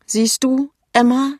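high, loud wounded complaint; indignant, tearful. A narrator's reading. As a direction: protest as if close to tears. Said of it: "entrüstet, aber auch weinerlich".

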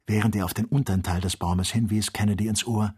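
ordinary delivery

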